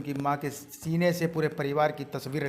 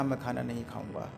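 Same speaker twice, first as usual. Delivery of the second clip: low, much softer, withdrawn refusal; quiet refusal; 5 semitones lower, 10 dB quieter than usual. Acted. Judge to his children, soft heartsick refusal. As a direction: push the plate away quietly, heartbroken.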